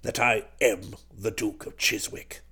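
put-on very low, odd old-duke voice; rummy voice. A narrator's reading. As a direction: assure them solemnly, right down low. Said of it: "he said in a rummy kind of voice".

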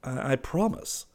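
stammered, rising in surprise; amazed. A narrator's reading. Clifford promising his friend silence, amazed.

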